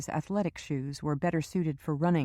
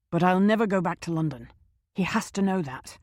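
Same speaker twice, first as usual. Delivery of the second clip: louder and faster on "never"; firm refusal; 2 semitones higher, 4 dB louder than usual. Acted. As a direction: plant your feet, no London. Shaw insisting.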